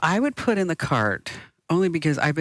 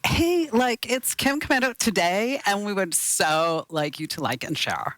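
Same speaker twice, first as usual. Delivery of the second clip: put-on much higher, gushing valley-girl host; perky host greeting, pitched way up; bubbly host intro; 5 semitones higher than usual. Shown as a demonstration. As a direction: sell it like an overeager influencer. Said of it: "I shouldn't say it like that".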